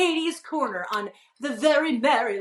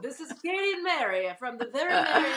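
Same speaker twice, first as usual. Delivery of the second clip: put-on radio host introduction; 3 semitones higher than usual. Shown as a demonstration. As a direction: announce the show like a Las Vegas host. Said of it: "like a radio voice".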